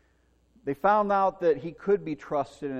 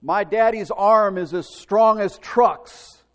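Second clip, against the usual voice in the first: put-on loud, slow boyish roar, much higher; roaring boast; 6 semitones higher, 12 dB louder, slower than usual. Little boy roaring to the neighborhood, loud slow boast. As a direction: bellow it proudly to the whole street. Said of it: "roar to the neighborhood".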